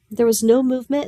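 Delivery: plain reading voice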